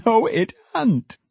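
high, drawn-out gleeful denial; burst of delight. A narrator's reading. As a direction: repeat it in helpless glee. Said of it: "in a burst of delight".

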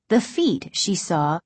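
ordinary delivery, nothing marked

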